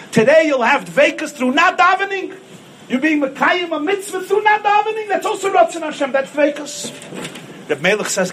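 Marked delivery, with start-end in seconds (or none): 0.1-6.9 high, lifted sermon voice; raised preaching. Acted. Rabbi preaching the story's point to the congregation, raised. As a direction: drive the lesson home.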